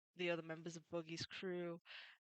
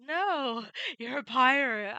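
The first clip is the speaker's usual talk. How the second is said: much louder and higher, laughing retort; indignant Nami; 6 semitones higher, 12 dB louder, slower than usual. Retold or imitated. Nami refuses Luffy's thanks, loud laughing retort. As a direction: fling the refusal back at him. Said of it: "And she's like".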